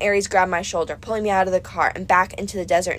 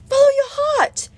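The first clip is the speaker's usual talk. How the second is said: very high and louder goddess; Aphrodite; 5 dB louder than usual. A narrator's reading. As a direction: sing it out, pitched way up. Aphrodite exclaiming.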